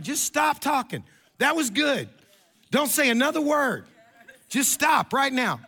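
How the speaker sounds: high-pitched, emphatic pleading to stop; exasperated, punchy orders with pauses between; exasperated publicist. Acted.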